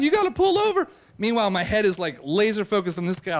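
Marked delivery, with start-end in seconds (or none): 0.0-0.9 high-pitched imitation of panicked yelling; mock yell. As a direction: yell it high like a passenger, without much volume. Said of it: "people are yelling at the bus driver".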